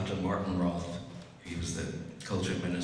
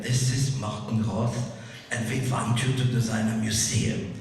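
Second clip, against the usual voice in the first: put-on heavy German accent; 4 dB louder than usual. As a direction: imitate a formal caller with a thick German accent. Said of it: "in a very heavy German accent".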